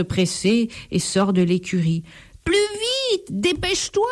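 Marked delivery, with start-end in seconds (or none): 2.4-4.1 high, desperate begging; pleading. A narrator's reading. The thief begs his donkey to hurry, desperate. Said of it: "supplie le voleur".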